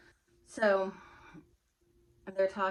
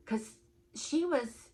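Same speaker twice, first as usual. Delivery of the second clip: usual speaking voice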